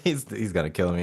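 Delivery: ordinary delivery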